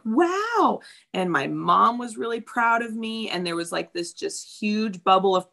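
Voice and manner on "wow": high, impressed wow; impressed teens. Retold or imitated. Teens admiring her weight loss, high awed wow. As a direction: squeal it like an awed teen. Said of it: "other fourteen-year-olds are like".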